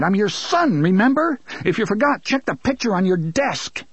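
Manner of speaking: high, pointed sarcasm rising to remember; sarcastic defiance. Acted. Son mocking his father, biting sarcasm. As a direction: needle him with it.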